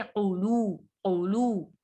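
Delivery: क़ुलू begun with a heavy q from the back of the throat; thick qaf. Shown as a demonstration. Teacher models the heavy letter, thick qaf. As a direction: make the q of क़ुलू full and heavy. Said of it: "क़ाफ को मोटा पढ़ेंगे".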